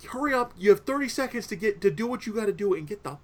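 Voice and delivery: high-pitched retold command; mock urgent order. Retelling homeowner ordering kids to hurry.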